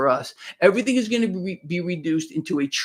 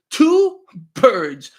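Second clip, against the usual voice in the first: normal talking voice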